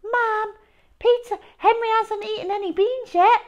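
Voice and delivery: put-on high prissy child voice, quick; goody-goody smugness; prim little brother. A narrator's reading. Peter asking Mum for a reward, high prissy voice. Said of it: "said Peter".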